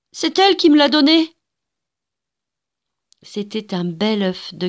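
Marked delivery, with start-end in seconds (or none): 0.1-1.4 put-on high, loud protest of a wronged child; crying out. A narrator's reading. Wronged little girl insisting the egg was given, loud cry. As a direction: shout it in your own defence. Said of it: "cria Antonella".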